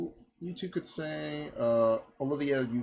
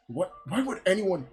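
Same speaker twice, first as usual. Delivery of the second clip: raised, fed-up protest, bursting loud on "fine"; exasperated; 4 semitones higher, 3 dB louder than usual. Acted. Hero agreeing to kill the creatures, acted exasperation.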